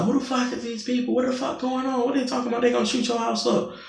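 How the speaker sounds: high, mocking imitation of the angry daughter; imitating the daughter. Narrator mimics the landlady's daughter yelling at her, high mocking voice. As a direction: squeak her lines up high like a mock. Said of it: "She was like".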